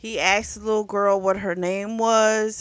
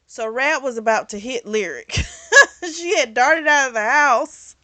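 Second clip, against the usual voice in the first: can't stop giggling while recounting the near miss; laughing while telling; 5 semitones higher, 6 dB louder than usual. Real, not acted. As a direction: talk through real laughter, voice jumping up. Laughs while telling about Lyric.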